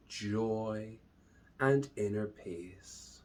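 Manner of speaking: slow, drawn-out meditation voice with long pauses; unhurried, measured calm, lingering on each phrase; guided meditation. Acted. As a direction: guide them calmly into the breath.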